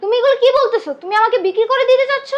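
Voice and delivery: high, loud disbelieving outcry; shocked protest. Acted. Young woman to sister-in-law selling her, shocked protest.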